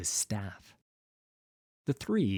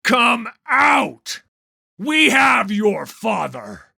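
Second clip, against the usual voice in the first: high, loud calling shout; 13 semitones higher, 13 dB louder, slower than usual. Acted. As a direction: yell it across the yard. Vannis calls toward the barn.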